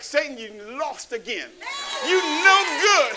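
building to a high, loud, drawn-out shout; defiant taunt. Acted. Preacher taunting Satan as he dances, defiant shout. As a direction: shout down the devil, stretching the last words.

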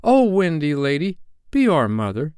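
put-on high, imploring children; lightly acted childlike plea; pleading cry. A narrator's reading.

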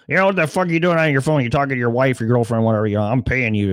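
fast, high mock boss rant; hammed-up scolding boss, rattled off quickly; angry boss. Boss scolding employee on phone.

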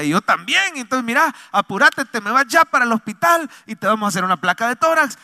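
imitated worried urgency; urgent relative. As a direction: hurry someone off to the hospital. Voices urgent relative ordering hospital trip.

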